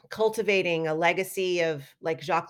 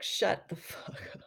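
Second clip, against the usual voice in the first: mock hushed retort; 8 dB quieter, slower than usual. Retorting to brother, mock dismissive.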